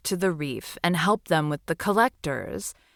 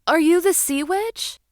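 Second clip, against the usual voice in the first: tiny, high and timid little seahorse; little seahorse calling into the cave; 11 semitones higher, 4 dB louder than usual. Acted. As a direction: squeak it out like a small sea creature. Small seahorse greets sea witch.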